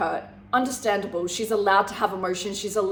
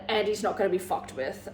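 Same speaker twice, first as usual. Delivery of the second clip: ordinary delivery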